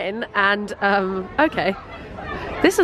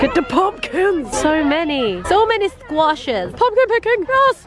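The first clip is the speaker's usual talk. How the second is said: thrilled; 6 semitones higher than usual. Real, not acted. Vlogger arriving at the pumpkin patch, thrilled cheer.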